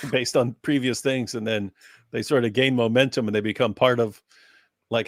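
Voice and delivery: ordinary talk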